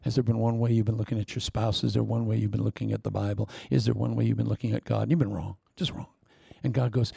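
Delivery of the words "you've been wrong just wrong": whisper. Acted. Sermon rebuke, dropped voice.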